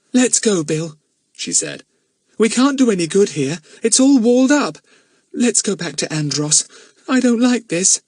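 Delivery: put-on high, pleading frightened girl; scared girl begging Bill to go; uneasy girl. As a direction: beg to leave, nervous. Lucy Ann uneasy.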